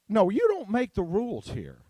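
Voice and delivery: ordinary delivery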